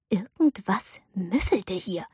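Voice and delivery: slow whisper, nose wrinkled; whispered disgust. A narrator's reading. Girl sniffs her fur, whispered disgust. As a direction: hush it out in disgust.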